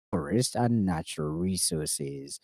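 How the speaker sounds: ordinary delivery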